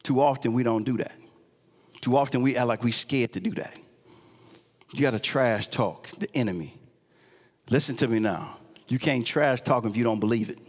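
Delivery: hushed sermon close. Acted. Preacher quietly pleading with the congregation, hushed. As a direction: bring it down low and confide it.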